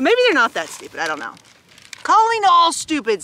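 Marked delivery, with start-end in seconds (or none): 2.0-3.2 higher, louder storybook call; read-aloud shout. A narrator's reading. Stanley summoning his family, shouting it out. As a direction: call the whole household in. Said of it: "Stanley shouted".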